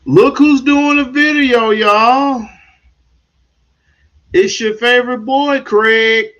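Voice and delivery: high, loud hype; excited intro. Real, not acted. Streamer hyping viewers about his own video. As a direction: greet the viewers like a host. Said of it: "announcing himself to viewers".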